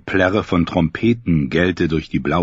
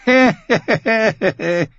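high booming knightly laugh; hearty laugh; 3 dB louder than usual. Acted. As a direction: laugh out loud like a brash knight. Sein Vetter lachte.

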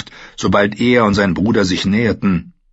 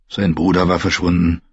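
ordinary delivery, nothing marked

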